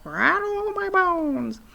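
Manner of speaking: slow, drawn-out mock ghost squawk; a parrot hamming up a ghost, slowly; spooky parrot. A narrator's reading. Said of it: "imitation of a spooky voice".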